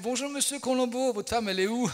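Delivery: loud, high, comic hello; joking greeting. Retold or imitated. Re-enacts his joke greeting to banker.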